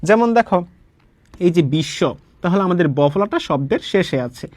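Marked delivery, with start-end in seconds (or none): 1.7-2.0 বিশ্ব with a long, doubled sh and no w. Shown as a demonstration. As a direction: say বিশ্ব as "bish-sho", the b-phala silent. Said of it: "তালব্য শটা হচ্ছে আমাদের দুইবার উচ্চারণ হবে".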